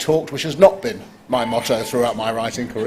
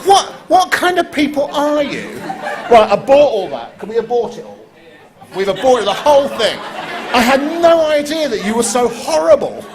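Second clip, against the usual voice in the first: much higher, laughing mock horror; mock outrage; 11 semitones higher, 3 dB louder than usual. Host mock-scolds the audience for wrong votes, laughing. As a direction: scold them in hammed-up disgust.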